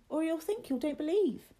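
put-on high-pitched young boy's voice; high, protesting little boy; William, scared and disbelieving. A narrator's reading.

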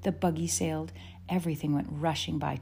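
plain reading voice

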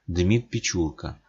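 ordinary delivery, nothing marked

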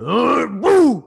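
loud, high imitated sneeze burst; bear sneeze. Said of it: "he sounded like a bear".

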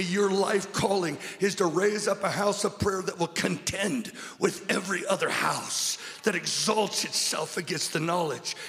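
loud, ringing preacher's build; preaching at full force. Acted. Preacher to his congregation, preaching at full force. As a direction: preach it over the music.

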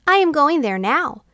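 put-on high, light child's voice; little girl voice. A narrator's reading. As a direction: say it like a young girl.

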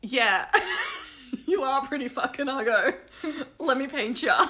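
comic artist urging the sitter on; imagined eager artist. Pretend. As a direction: cheerfully agree through giggles. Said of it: "And then he says".

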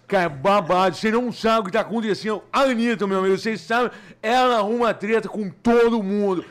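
fofoqueiro falando meio pra dentro, enfático; Léo Dias. Acted. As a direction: esticar as palavras-chave, voz mais alta, contando babado. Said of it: "Como é que é o Léo Dias?". (imitação de colunista de fofoca)

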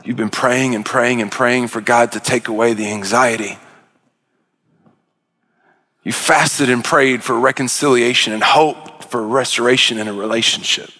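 low, hushed preaching to the hurting. Acted. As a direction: lean in and speak gently to the congregation. Soft, intimate closing appeal.

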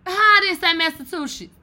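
high, loud outraged question; indignant disbelief. Real, not acted. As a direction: protest in disbelief. Indignant reply to the pronunciation.

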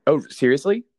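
loud, high delighted surprise; surprised. Real, not acted. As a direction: react with thrilled disbelief.

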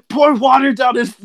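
high, frantic mock command; retold urgent order. Dad telling mom to pour water, retold frantic order. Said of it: "telling my mom".

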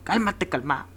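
hammed-up wizard telling the demon to chill; mock calming wizard. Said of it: "Algo así como que". (voz aguda de burla, como mago calmando al demonio)

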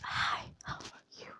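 soft unvoiced whisper. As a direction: breathe the greeting in a whisper. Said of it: "imagine a person talking to you like this".

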